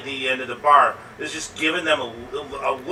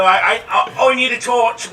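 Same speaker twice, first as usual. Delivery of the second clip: higher, louder mock-Cockney voice; put-on Cockney barman, pitched up; 7 semitones higher, 5 dB louder than usual. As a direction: stammer it out as a hammed-up Cockney. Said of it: "I guess he's Cockney too".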